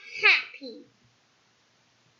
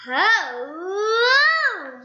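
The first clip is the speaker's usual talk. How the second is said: a higher, louder cheery hello; cheerful greeting; 5 semitones higher, 7 dB louder than usual. Acted. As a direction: greet him brightly as jolly Mister Happy. Mister Happy greets cheerfully.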